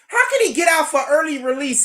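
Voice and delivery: yelling the question at chat; incredulous yell. Real, not acted. Streamer yelling at chat about early release, incredulous.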